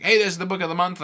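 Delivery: high, loud, fast mock announcement; loud sticker shouting its own name; voicing a pushy badge. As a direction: play the badge yelling for attention. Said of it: "badges that is like".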